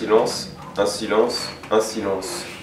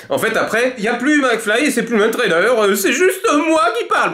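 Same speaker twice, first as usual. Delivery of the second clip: laughing; 13 semitones higher than usual. Real, not acted. Giggling while explaining.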